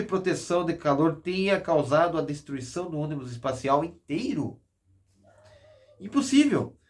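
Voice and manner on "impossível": high, loud disbelief; exclaimed. Acted. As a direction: cry out impossible. Investigators' verdict, exclaimed.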